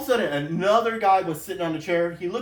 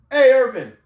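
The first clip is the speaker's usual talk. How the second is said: loud, raised supervisor's call; 6 semitones higher, 9 dB louder than usual. Retold or imitated. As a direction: holler to get a coworker's attention. Retold supervisor calling out.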